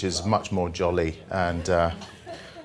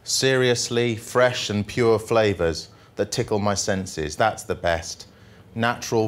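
jolly poem reading; 2 semitones higher than usual. Acted. Comic poet reading the happy poem to the audience, upbeat. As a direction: read it like a cheerful ode.

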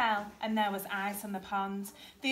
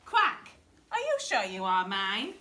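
puzzled storybook duck, opening on a sharp quack; mother duck; 6 dB louder than usual. A narrator's reading.

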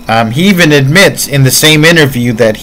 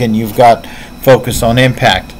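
ordinary talk

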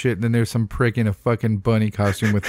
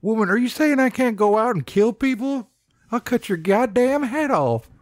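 mock outraged hillbilly, pitched way up; ranting Bunny Man; 12 semitones higher than usual. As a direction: rant at her as an outraged backwoods killer. Acting Bunny Man's threat in an accent.

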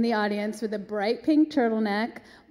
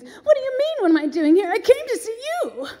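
high, loud, swooping star impression; 12 semitones higher, 7 dB louder than usual. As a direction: exclaim it like an incredulous stage diva. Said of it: "And she goes".

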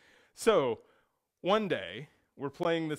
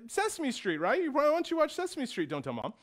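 much higher, sweet coaxing voice; sugary appeasing brothers; 9 semitones higher than usual. Retold or imitated. Older brothers bribing hurt brother with Sesame Street. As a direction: sweeten it way up to buy his silence. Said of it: "And they said".

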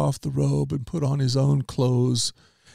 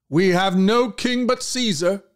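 much higher, slow retort; shouted back; 8 semitones higher than usual. Acted. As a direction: throw it back at Pilate. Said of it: "shouted back".

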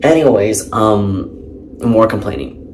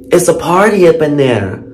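excited; 6 semitones higher, 6 dB louder than usual. Retold or imitated.

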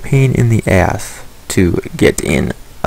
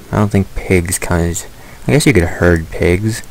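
normal talking voice

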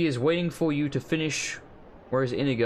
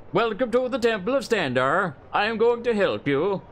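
higher-pitched imitation of a Nord-like accent; mock Nord-like accent; 6 semitones higher than usual. Gamer mimicking the voice actor's dubious accent, mock Nord-like. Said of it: "trying to do a Nord-like accent".

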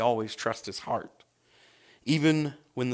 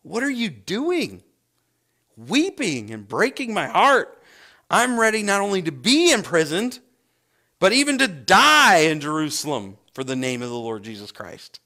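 higher and louder, passionate declaration; Paul's bold answer; 4 semitones higher, 6 dB louder than usual. Retold or imitated. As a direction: proclaim it with fervent resolve, higher and louder. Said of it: "This is how he answers them".

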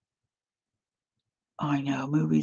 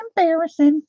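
"embarrassing" repeated higher and louder; higher mock dismay; 10 semitones higher, 6 dB louder than usual. Real, not acted. Telling viewers crying is embarrassing, hammed-up high echo.

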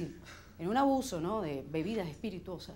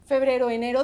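usual speaking voice

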